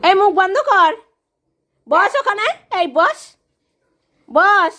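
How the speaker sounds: loud, high scolding bark of orders; angry shout at dog. Real, not acted. Shouting at barking Butu to sit, angry and loud. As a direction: shut the dog up, sharp and loud.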